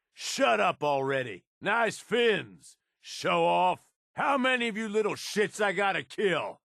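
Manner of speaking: usual speaking voice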